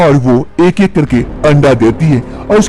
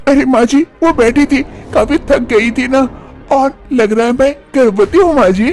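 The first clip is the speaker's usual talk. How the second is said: put-on high-pitched young wife's voice, complaining; young wife Manisha; 11 semitones higher than usual. Manisha talks to mother-in-law.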